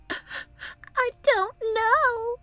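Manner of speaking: high, slow, whimpering; sobbing. Acted. Sniffles to worried family about her foot, sobbing. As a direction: wail it through fake tears. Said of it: "she sobbed".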